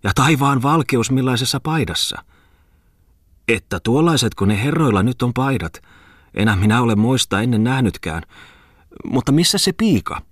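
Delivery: high-pitched, amazed village matron; astonished exclamation. A narrator's reading. Miller's wife gaping at the gentleman's shirt, astonished exclamation. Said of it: "huudahti myllärin emäntä".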